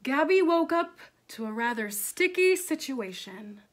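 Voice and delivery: loud, rising storyteller; dramatic story narration. Acted. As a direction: build the sticky disaster for young listeners.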